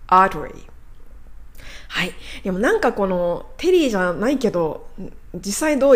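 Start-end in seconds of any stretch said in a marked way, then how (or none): 0.1-0.7 アーテリー as English "artery", no clear "te"; English pronunciation. Shown as a demonstration. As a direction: say アーテリー like "AR-duh-ree". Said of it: "テリーって言ってない感じしますね".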